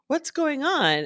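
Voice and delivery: high, worried "What's going on?"; concerned friend. Retold or imitated. Friend asking why she burst into tears, high and alarmed.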